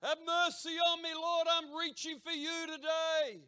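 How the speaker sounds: full-throated high yell, sustained; yelled prayer. Shown as a demonstration. Preacher demonstrating a literal shout of faith, yelling. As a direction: holler it out to the open sky. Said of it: "and yell out".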